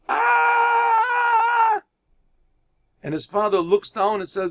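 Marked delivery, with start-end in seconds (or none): 0.1-1.8 a high, loud wordless cry for help; desperate cry. Acted. Lost son cries out to his father, desperate high cry. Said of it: "so he screams out to his father".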